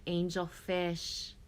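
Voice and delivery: usual speaking voice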